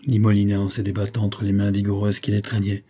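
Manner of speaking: plain reading voice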